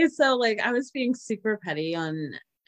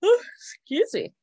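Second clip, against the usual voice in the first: laughing; 9 semitones higher, 4 dB louder than usual. Real, not acted. Laughing fit, high and loud.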